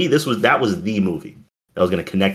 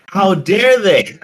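mock outrage; 12 semitones higher, 5 dB louder, slower than usual. Mock outrage at movie memory.

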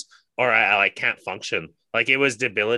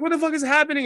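high-pitched startled exclamation; alarmed demand; faster than usual. Retold or imitated. Partner rushing in after hearing screams, alarmed.

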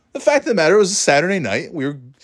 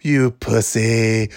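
taunting; much slower than usual. Retold or imitated. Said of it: "then he goes".

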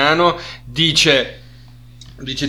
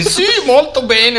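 German accent in Italian; 13 semitones higher, 3 dB louder than usual. Shown as a demonstration. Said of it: "Fallo un po' così".

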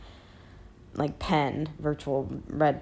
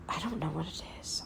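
whispered to herself, curious; hush, puzzled by a noise; 9 dB quieter, faster than usual. Real, not acted. Puzzling over a strange sound.

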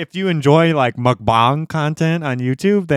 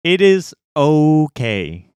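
"okay" stretched out slowly; slow, deliberate weight on "okay"; measured verdict; much slower than usual. Real, not acted. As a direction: draw out "okay" syllable by syllable. Grudging verdict on the videos.

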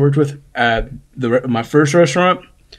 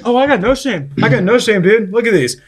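mock coworker; 8 semitones higher than usual. Coworker voiced in retold story.

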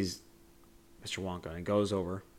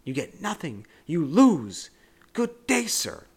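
much higher and louder mock roar on good day; imitating Wonka yelling; 7 semitones higher, 6 dB louder, slower than usual. Retelling Wonka's final blow-up, much higher mock yelling. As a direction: explode on the dismissal. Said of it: "he is absolutely yelling".

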